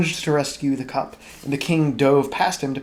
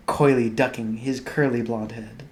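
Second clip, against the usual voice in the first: plain reading voice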